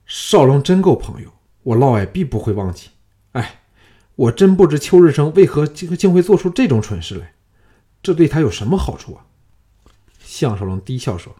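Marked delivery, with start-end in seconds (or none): 0.0-9.2 rising higher and louder, then rushed; shocked official pouring out gratitude and bewilderment; shaken and worked up. Acted. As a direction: blurt it out in agitated disbelief. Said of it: "嫪毐巨震说".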